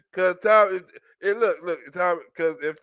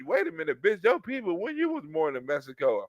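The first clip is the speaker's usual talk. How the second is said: ordinary talk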